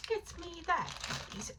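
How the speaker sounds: hammed-up posh English order; mock posh English accent. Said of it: "posh accent".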